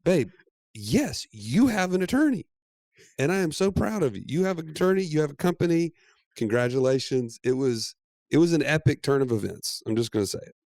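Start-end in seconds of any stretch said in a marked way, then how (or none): 0.0-7.4 mock pride. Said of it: "And I'm like".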